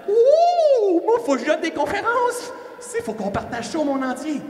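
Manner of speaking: high, loud, squealing inner clown. Retold or imitated. Speaker voices his inner clown begging to give talks, squealing. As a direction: bursts out like an excited inner clown. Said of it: "il faisait comme".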